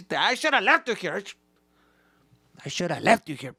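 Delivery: high, loud bitter rebuke; angry dad. Retold or imitated. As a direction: snap at the kid. Dad yelling.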